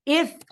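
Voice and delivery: "If" pitched high and punched; emphatic if. Shown as a demonstration. Teacher cueing class with hand signal, emphatic "If". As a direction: call out "If" like a signal. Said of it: "You can do it with me".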